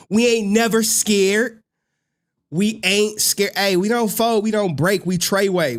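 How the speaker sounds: rally the crew; hyped boast. Real, not acted. Hyping up his crew.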